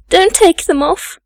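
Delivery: put-on high-pitched child's plea; pleading cry. A narrator's reading. Young girl begs the maids not to tie her down, pleading cry. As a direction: cry out to stop them. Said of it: "I cried".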